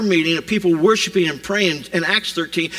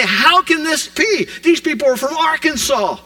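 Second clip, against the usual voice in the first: astonished crowd; 8 semitones higher than usual. Retold or imitated. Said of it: "And they say".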